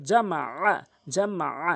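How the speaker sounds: "জামা আ" with a pharyngeal ain on the final "আ"; proper Arabic ain. Shown as a demonstration. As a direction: squeeze the last "আ" of "জামা আ" from mid-throat. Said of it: "আইন বলতে হবে". ("জামা আ"-র শেষে গলা থেকে আইনের উচ্চারণ)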